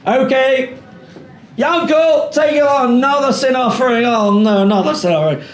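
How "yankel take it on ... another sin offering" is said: high, louder call, sinking into dismay at the end; busy priest shouting, then wearily giving up. Pretend. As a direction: summon Yankel, then groan at yet another sin offering. Priest calling to colleague.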